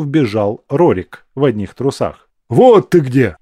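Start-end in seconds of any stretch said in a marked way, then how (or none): 2.5-3.4 loud exclamation of discovery. A narrator's reading. Rorik bursting into the room, exclaiming loudly. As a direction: call it out on finding him. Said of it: "воскликнул он".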